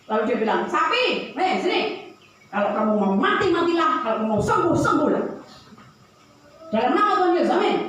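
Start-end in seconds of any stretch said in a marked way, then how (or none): 0.7-1.8 calling the cow. Retold or imitated. Pastor calls the cow. 2.5-7.8 lightly voiced pastor, dismissive prayer; tossed-off blessing; offhand prayer. Pastor's offhand prayer over cow.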